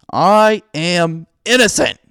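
slow, loud, high cry; yelled. A narrator's reading. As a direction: yell it into the emptiness. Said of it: "I yelled".

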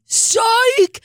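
high, loud squealed psych; teacher's fake reveal, high and hammed-up; mock gotcha shout. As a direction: yelp the word like a prank reveal. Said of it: "She's like".

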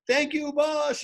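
high-pitched mock weepy gratitude; imitated tearful thanks. Grateful commentator phones his mentor yearly, mock tearful. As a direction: imitate someone crying their thanks. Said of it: "would cry".

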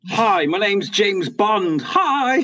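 high, slowly drawn-out mock spy introduction; James Bond. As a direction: ham up a spy's introduction, pitched high. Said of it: "I'm a spy".

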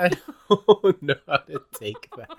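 laughing. Real, not acted. Echoing the line through laughter.

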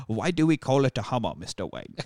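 Cockney butler impression, low on the name; mock Cockney, trailing down deep; Michael Caine. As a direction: do a hammy Cockney butler, dropping at the end. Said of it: "I'm Michael Caine".

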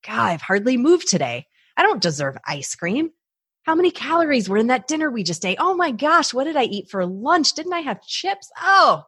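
high-pitched fretting, rising in alarm; mock worry spiral. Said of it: "I suddenly have a thought".

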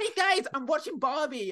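high, loud mock influencer; mocking poster. Moviegoer showing off to followers online, mocked in a high voice. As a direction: imitate an excited social media post. Mocking people posting from the cinema.

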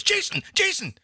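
high eager shout of the name; excited answer. Retold or imitated. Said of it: "And she goes".

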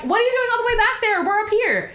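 high, quick mock holler; retold yelling. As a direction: call out like a friend far ahead. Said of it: "the friend is yelling".

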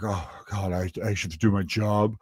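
mock reluctant desk clerk; couldn't be bothered. Clerk turns up at midnight, imitated as couldn't be bothered. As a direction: act like doing the job is a chore. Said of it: "he couldn't be bothered".

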